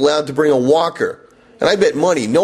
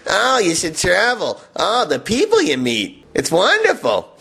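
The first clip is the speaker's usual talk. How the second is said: high-pitched, slightly drawn-out travel enthusiast; mock gushing; 5 semitones higher than usual. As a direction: parody someone raving about travel. Mocking what travel lovers say.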